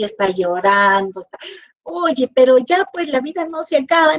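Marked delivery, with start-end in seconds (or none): 1.8-4.2 pep talk. Retold or imitated. Encouraging a sad friend.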